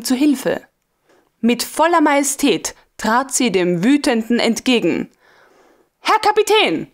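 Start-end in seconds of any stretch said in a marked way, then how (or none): 6.0-6.9 high, sharp call of command. A narrator's reading. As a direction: snap to attention at him. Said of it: "rief sie mit Kommandoton".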